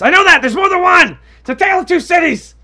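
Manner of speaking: high, full-volume comic rant; exasperated shouting. Acted. Storyteller answering an imagined nitpicker, exasperated shouting.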